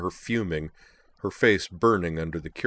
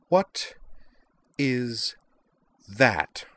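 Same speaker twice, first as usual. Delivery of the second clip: higher, each word set apart by pauses; demanding; 6 semitones higher, much slower than usual. A narrator's reading. Liza spotting the signet on Sky's hand, demanding. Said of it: "she demanded".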